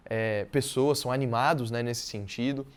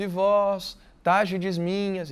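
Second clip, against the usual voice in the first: recited verse; 2 semitones higher, 4 dB louder, much slower than usual. Shown as a demonstration. Teacher recites Camões invocation.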